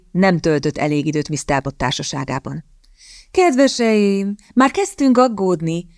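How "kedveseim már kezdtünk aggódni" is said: slow, high lady's call. Acted. Lady Redcliffe greeting the returning couple, high drawn-out call. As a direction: call across to them in a drawn-out higher voice. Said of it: "kiáltotta Lady Redcliffe".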